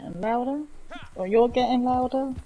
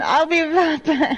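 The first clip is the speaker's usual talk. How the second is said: laughing; 4 semitones higher, 6 dB louder, slower than usual. Real, not acted. Hurrying off after the bison.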